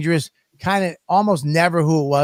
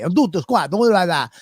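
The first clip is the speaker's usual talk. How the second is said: mock Italian; 4 semitones higher than usual. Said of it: "Italian's the same way".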